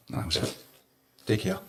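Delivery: barely getting words out through tears; real tears, voice almost gone; overcome with emotion. Real, not acted. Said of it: "I'm getting emotional".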